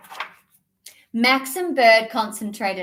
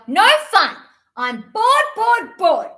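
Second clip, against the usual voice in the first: loud, high frustrated yell; bird yelled; 9 semitones higher, 7 dB louder than usual. Acted. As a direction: stamp and shout in a tantrum. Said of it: "bird yelled".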